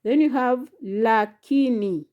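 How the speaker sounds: ordinary talk, nothing marked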